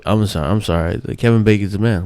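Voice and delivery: ordinary talk, nothing marked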